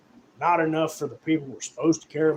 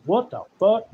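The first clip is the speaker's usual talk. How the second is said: shocked; 6 semitones higher than usual. Retold or imitated. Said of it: "I was like".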